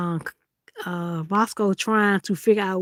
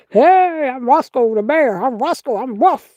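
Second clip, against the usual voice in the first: louder, tough-guy teddy bear bragging; voicing the bear; 2 semitones higher, 6 dB louder, slower than usual. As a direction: puff up like a toy bear acting rough, loud.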